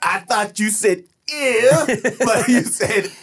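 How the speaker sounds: high, slow hammed-up French accent; mock French, laughing through it; fake French accent. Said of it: "We are just doing an exaggerated, uh, accent".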